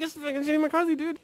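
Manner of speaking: high, half-voiced mock urging; retold urgent plea. Pleads with bartender in retold dream.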